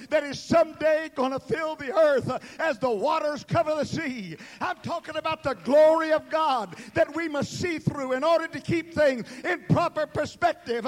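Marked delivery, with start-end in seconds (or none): none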